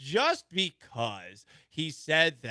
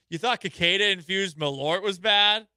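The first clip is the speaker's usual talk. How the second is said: radio tease; 4 semitones higher, 7 dB louder, slower than usual. Acted. Show tease read.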